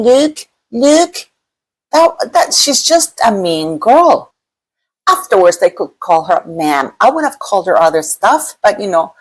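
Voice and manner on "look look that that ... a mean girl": high, lightly put-on catty voice; a hint of a mean-girl impression; mocking Meghan. Mocking Meghan's dig at Mindy Kaling.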